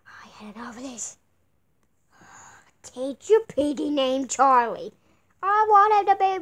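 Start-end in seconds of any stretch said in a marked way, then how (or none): 0.1-1.2 breathy whispered aside; fed-up whisper. Acted. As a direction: whisper it, worn out.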